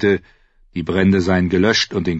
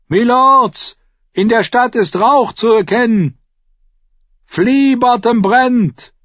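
guard shouting; 8 dB louder, slower than usual. Acted. Guard calls warning down tower.

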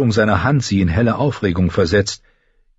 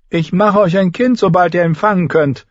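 high, sneering king voice; mocking boy-king; 10 semitones higher, 3 dB louder than usual. A narrator's reading. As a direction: belittle her like a cruel child. Joffrey threatens Sansa. (hoher, spöttischer Knabenkönig)

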